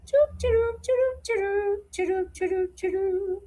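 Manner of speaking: high playful train chant; choo choo. Real, not acted. Playing with train sounds, high chant. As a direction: chug it like a toy train.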